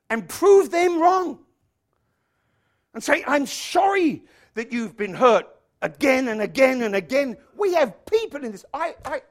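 high, loud preacher's delivery; full-force preaching. Real, not acted. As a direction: drive it home to the congregation.

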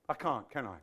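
ordinary talk, nothing marked

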